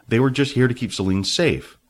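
plain reading voice

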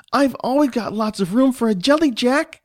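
put-on high-pitched child enthusiasm; eager little boy. Johnny assures Mom he has room, eager. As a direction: insist like an excited small boy.

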